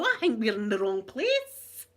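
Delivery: mock-worried newcomer, rising at the end; bewildered visitors. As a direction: imitate baffled visitors to Scotland. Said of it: "People land in Scotland go".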